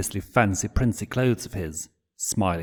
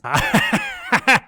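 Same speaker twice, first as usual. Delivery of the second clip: high, loud giggle fit; helpless giggling; 6 dB louder than usual. Acted. Blitz pinned on the floor, giggling helplessly. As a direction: giggle uncontrollably. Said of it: "still giggling and laughing".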